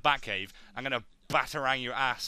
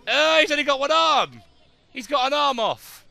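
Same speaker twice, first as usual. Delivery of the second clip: high, loud gleeful yelp; loud, high excitement at gory detail; excited shock; 13 semitones higher, 12 dB louder than usual. Real, not acted. Shocked at a severed arm.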